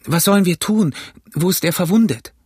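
high, urgent questions; anxious call. Acted. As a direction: plead with the doctor in alarm. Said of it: "riefen wir". (aufgeregtes, besorgtes Rufen)

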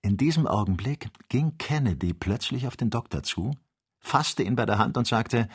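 ordinary delivery

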